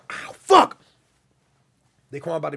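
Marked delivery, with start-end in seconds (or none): 0.1-0.8 sudden high, loud yelp; pained outburst. Real, not acted. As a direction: cry out as if just hurt. Sudden exclamation of pain.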